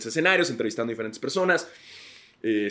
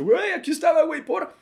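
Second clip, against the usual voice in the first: high-pitched baffled protest; squeaky startled innocence; confused surprise; 4 dB louder than usual. Retold or imitated. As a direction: play your past self caught off guard, much higher. Own past self, confused.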